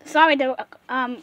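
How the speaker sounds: usual speaking voice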